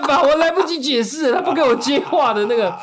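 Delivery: laughing, high and loud. Real, not acted. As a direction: laugh through the words. Laughing while telling the story.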